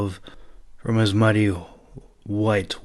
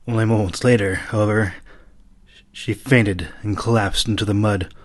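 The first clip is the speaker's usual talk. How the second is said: plain reading voice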